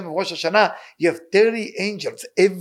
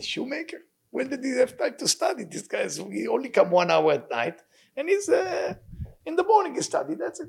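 high, disbelieving voice; 7 semitones higher, 3 dB quieter than usual. Acted. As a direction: raise the pitch in mocking disbelief.

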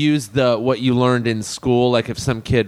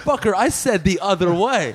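higher, hammed-up bully scolding; mock aggression; 6 semitones higher than usual. Gangbangers to comic leaving taxi, mock aggression. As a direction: imitate the bully catching him once more. Listener acts out the gangbanger's order.

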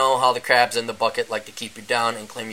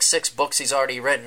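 ordinary delivery

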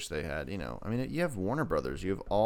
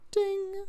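high sung ding; a bright bell-like ding, much higher; ding sound. Pretend. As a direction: chime it like a bell. Game sound effect.

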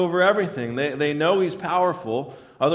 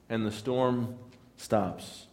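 ordinary talk, nothing marked

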